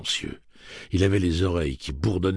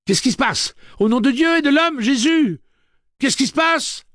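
high, full-force panicked yell; loud high-pitched outburst; alarmed shout; 10 dB louder than usual. A narrator's reading. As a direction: cry out in alarm over a siren. Rosa runs out amid the siren.

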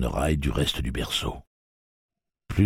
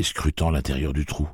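plain reading voice

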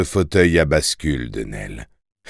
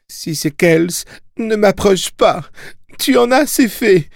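high, frightened injured woman; panicked mother; 14 semitones higher, 3 dB louder than usual. Acted. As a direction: plead in terror, voice climbing. (voix de mère paniquée, aiguë)

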